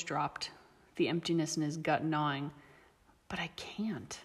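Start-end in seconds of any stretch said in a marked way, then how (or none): 3.3-4.2 soft, whispered and hopeless; despairing whisper. A narrator's reading. Remus whispers to the girl, hopeless. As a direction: breathe it out, defeated.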